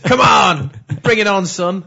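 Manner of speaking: loud, laughing challenge; loud taunt. Pretend. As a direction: dare the sun to burn you.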